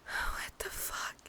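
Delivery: slow, shocked whisper; hushed disbelief; stunned whisper. Acted. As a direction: breathe it in disbelief. Creature does something strange.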